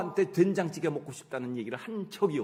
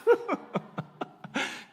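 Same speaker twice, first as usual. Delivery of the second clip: a burst of high, breathy laughter; a preacher laughing at his own chair prayer; 3 dB louder than usual. Real, not acted. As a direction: crack up at your own joke.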